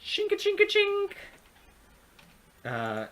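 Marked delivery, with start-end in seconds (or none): 0.0-1.1 high chinking sound effect; coin clinking noise. Pretend. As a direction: chirp out the coin jingle. GM voices coins clinking.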